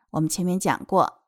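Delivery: usual speaking voice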